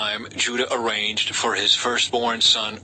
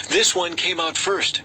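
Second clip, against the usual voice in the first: a raised, higher announcement of the firstborn; announcing; 6 semitones higher than usual. A narrator's reading. Midwife announces which twin came first, raised and bright. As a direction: call it out proudly for the room.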